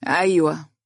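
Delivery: weary phone pickup; bored, sluggish. A narrator's reading. Woman answering an unwelcome phone call, bored and sluggish. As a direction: answer with listless reluctance. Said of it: "فزفرت بملل وردت بتثاقل قائلة".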